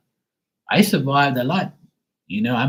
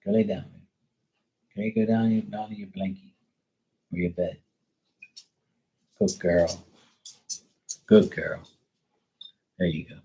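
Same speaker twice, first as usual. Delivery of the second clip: soothing a scared dog; 3 semitones lower, 3 dB quieter than usual. Real, not acted. Soft low praise to dog.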